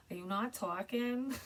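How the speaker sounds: high-pitched, hammed-up New York accent; crap New York accent. Shown as a demonstration. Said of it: "kind of a crap accent".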